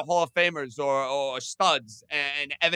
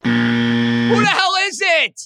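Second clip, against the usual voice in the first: exasperated shout; 5 semitones lower, 8 dB louder than usual. Real, not acted. Exasperated at missed trivia guesses.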